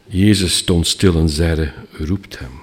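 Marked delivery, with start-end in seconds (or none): 1.9-2.5 quiet command. Retold or imitated. Jesus gives calm order.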